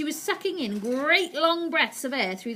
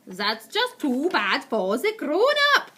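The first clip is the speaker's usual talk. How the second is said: slow, loud, high cruel witch; Grand High Witch voice; 5 semitones higher, 8 dB louder, slower than usual. Acted. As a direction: sneer it slowly like a heartless witch. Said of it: "said the Grand High Witch".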